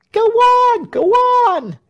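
high loud shout. A narrator's reading. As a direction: urge him on, shouting. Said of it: "shouted the crowd".

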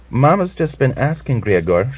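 high, coaxing sweet voice; wheedling. Acted. Natalia coaxing her husband Griegor, wheedling. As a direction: coax him with a smile. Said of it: "broke into a wheedling smile".

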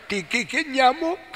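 lightly voiced child, high pitch; crying child. Child answers why they cry, high mock-tearful voice. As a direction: pitch it up like a tearful little kid. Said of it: "kalau anak itu nangis".

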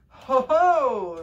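loud, high joyful ho ho; gleeful laugh. A narrator's reading. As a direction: burst out in a gloating laugh. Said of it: "said the elder princes to themselves in great joy".

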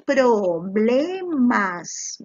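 "Problemas" very slowly, each syllable held; drawn-out dictation of "Problemas" by syllables. Shown as a demonstration. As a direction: stretch out every syllable of "Problemas". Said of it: "Escribe separando en sílabas".

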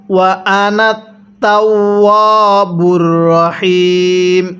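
ওয়া আনা short, তাওয়াবুর রহিম slowly chanted; slow recitation. Shown as a demonstration. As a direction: recite without stretching আনা. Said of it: "কিভাবে পড়ব?".

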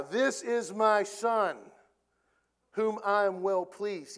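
slow, weighty, proclaiming; voice of God. Pretend. God interrupting Peter from heaven, slow and weighty. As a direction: boom it out slowly like God from heaven.

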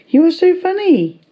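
high squeal of delight. Real, not acted.

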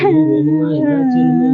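high, loud mock-sweet voice; overdone coaxing. Husband asking crying wife what happened, overdone coaxing. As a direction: coo it in exaggerated sympathy. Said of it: "இது over aunty".

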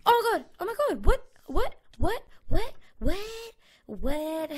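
high, loud flustered babble; panicked. Real, not acted. Gamer attacked by a snake, panicked high loud babble. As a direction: rattle it off in a panic.

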